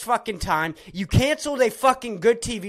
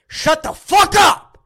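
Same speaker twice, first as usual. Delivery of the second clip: explosive high, loud yell; furious outburst; sudden angry shout; 9 semitones higher, 10 dB louder, slower than usual. Real, not acted. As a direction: burst out yelling, cutting them off. Sudden outburst at imagined people.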